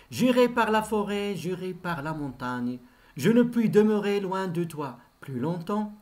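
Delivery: declaimed verse, each line launched firmly; poem recitation. Acted. Teacher showing pupils what a stanza is, declaimed verse. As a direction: recite the stanza in sustained, rhythmic phrases.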